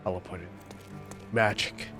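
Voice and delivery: ordinary talk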